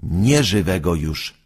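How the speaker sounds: plain reading voice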